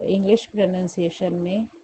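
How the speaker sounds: ordinary talk, nothing marked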